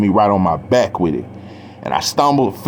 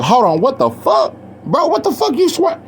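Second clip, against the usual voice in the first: stunned protest; 13 semitones higher than usual. Retold or imitated. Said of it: "and I'm like".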